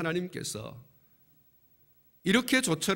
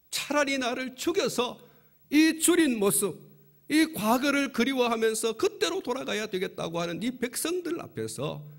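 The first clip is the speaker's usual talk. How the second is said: anguished outcry; 8 semitones higher, 3 dB louder than usual. Acted. Moses cries out to God.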